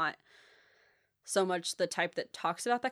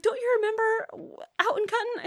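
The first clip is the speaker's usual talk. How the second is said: much higher prodding mom; voicing her mom; 5 dB louder than usual. Mom presses her to remember, higher prodding voice. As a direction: nudge her memory like mom.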